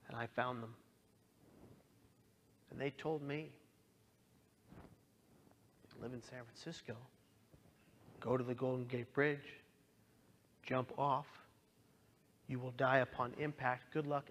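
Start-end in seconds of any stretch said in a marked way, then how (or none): 4.8-14.2 hushed reading. Retold or imitated. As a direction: read the cold instructions barely above a breath. Reading suicide instructions from online.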